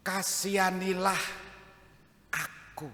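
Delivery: high-pitched, emphatic plea for mercy; pleading cry. Acted. Blind Bartimaeus begging Jesus for mercy, pleading cry. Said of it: "Apa yang dimohon pertama kali".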